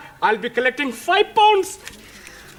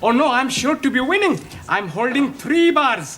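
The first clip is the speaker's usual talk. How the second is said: put-on polite Indian-English accent, eager to win; courteous Indian accent, sure of his luck.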